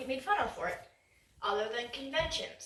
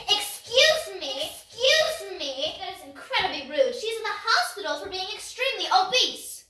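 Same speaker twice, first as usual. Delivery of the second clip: offended person; 7 semitones higher, 5 dB louder than usual. Retold or imitated. Mocking offended listener.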